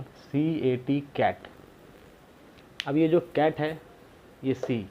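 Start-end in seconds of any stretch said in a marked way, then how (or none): 0.0-1.5 "सी ए टी कैट" spelt slowly, then the word said whole; slow spelling out. Shown as a demonstration. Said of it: "for example". (अक्षर-अक्षर धीरे-धीरे बोलना)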